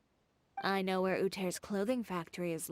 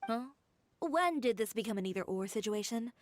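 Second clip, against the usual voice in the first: ordinary delivery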